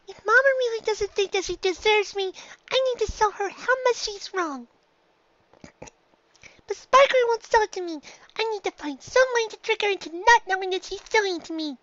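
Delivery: high filly voice, determined; a filly making up her mind; young filly Dinky, determined. A narrator's reading. Filly telling herself her plan, high and determined.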